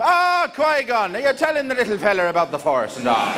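Yoda as a chirpy Irish leprechaun; Irish accent. Retold or imitated.

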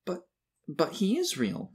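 high, slow, halting protest; through tears. A narrator's reading. Boy defends Santa to laughing classmates, tearfully. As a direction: plead as a tearful little boy. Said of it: "Tim said through tears".

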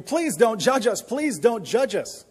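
high, loud pleading cry; mock scream. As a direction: cry out in imitated panic, begging.

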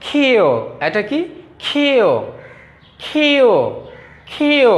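ক্ষীয় called out high, falling at the end; pronunciation demo. Shown as a demonstration. Teacher models the letter for pupils, pronunciation demo. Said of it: "তোমরা আমার কাছ থেকে প্রথমে শোনো".

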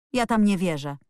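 plain reading voice

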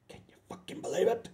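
hushed, fast, breathless aside; amazed whisper. Acted. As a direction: whisper it like a secret.

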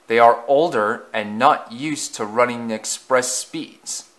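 ordinary delivery

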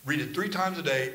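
usual speaking voice

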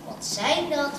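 plain reading voice

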